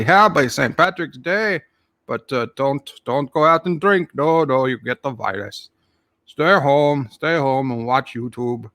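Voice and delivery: usual speaking voice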